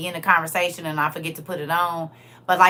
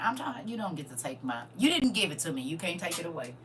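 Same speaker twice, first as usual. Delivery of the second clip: usual speaking voice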